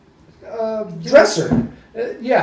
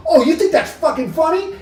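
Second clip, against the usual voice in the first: high-pitched, indignant challenge; retold anger; 10 semitones higher than usual. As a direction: act out being instantly lit up. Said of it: "I was mad. I was, like, instantly lit up".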